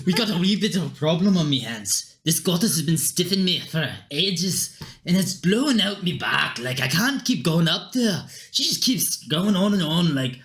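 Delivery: grumbling Scottish brogue; Scottish accent. Acted. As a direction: complain like a put-upon Scot. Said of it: "Don't know why he's Scottish".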